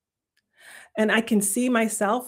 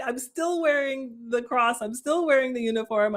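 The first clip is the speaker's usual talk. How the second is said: normal talking voice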